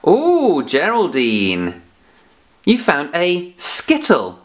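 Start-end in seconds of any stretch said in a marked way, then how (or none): 0.1-0.6 delighted ooh. Pretend. Puppet finds an object.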